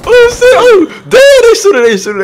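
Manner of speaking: high and loud alarm under fire; startled shout. Real, not acted. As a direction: yell in sudden panic. Ambushed by gunfire.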